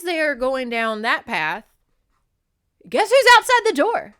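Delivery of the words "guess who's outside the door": real high-pitched scream into the mic; over-excited, very loud and high. Real, not acted. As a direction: shriek the big reveal at full volume. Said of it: "I'm screaming into this microphone".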